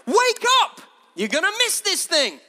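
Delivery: high, loud wake-up call; shouting. Retold or imitated. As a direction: shout to rouse a sleeping crowd. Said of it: "he's shouting exactly the same thing".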